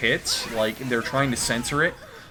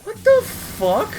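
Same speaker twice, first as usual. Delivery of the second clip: squeaky damsel scheming, much higher; high cartoon heroine voice; high girly heroine voice; 5 dB louder, slower than usual. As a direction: play the plucky captive girl. Heroine voice reacting.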